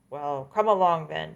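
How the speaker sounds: ordinary delivery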